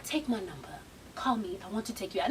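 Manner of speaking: low, hushed come-on; soft, deep-pitched sweet-talk; smooth suitor. Retold or imitated. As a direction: imitate a suave man asking her out. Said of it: "He was like".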